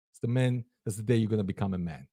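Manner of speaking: ordinary talk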